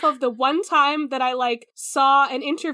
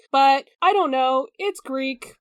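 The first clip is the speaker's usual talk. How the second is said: ordinary talk, nothing marked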